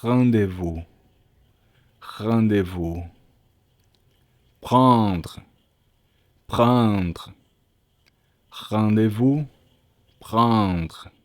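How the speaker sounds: Rendez-vous and Prendre with a back-of-throat French R. Shown as a demonstration. Teacher modelling the French R for students, French pronunciation. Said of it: "vai ter sempre esse som".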